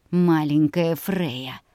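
slow purring villain. Acted. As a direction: purr it in her ear. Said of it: "промурлыкал мне на ухо".

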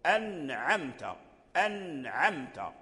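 slow أنعمت with a clear sounded nun. Shown as a demonstration. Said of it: "يسمعك أنك نطقت بالنون واضحة".

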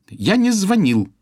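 slow, high, loud snap; slow cutting denial; curt retort. A narrator's reading. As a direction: cut him off flatly. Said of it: "отрезала Ирис".

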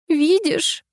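sharp high cry of Видишь; sudden exclamation. A narrator's reading. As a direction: blurt it out suddenly, pitched high. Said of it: "вдруг воскликнула Малова".

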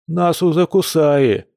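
"узакусаи" high and slow, mushy with dropped consonants; swollen-tongue speech. A narrator's reading. Stung Kir cutting in, "узакусаи" garbled by a swollen tongue. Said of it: "мрачно вставил Кир".

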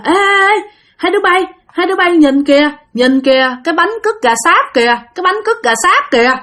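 put-on high, excited child voice calling out; kid shouting excitedly. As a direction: point and yell like a cheeky kid. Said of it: "nó la làng lên".

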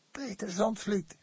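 hoarse. A narrator's reading. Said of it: "Haar stem klonk schor".

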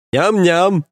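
put-on high, eager baby babble; childish glee. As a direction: coo it like a hungry toddler. Idiot reaches for breast.

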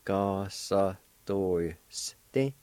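Gasa Doiste drawn out very slowly, in two parts; very slow syllables. Shown as a demonstration. Teacher slowing Gasa Doiste down for the class. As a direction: stretch Gasa Doiste apart. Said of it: "pronounced like this".

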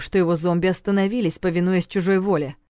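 plain reading voice

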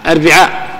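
أربعاء said erbiʕa with kasra on ب; i in the middle. Shown as a demonstration. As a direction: say أربعاء with an i after the b. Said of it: "تثلث العين".

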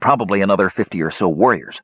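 ordinary delivery

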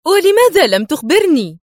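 high, loud indignant question; exclaiming. A narrator's reading. Woman kept uninformed by the man, crying out. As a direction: burst out demanding an answer. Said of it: "هتفت".

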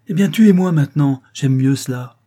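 loud, high, reckless challenge; defiant outcry. A narrator's reading. Rose daring her husband to kill her, defiant outcry. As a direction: fling it out at full voice, daring him.

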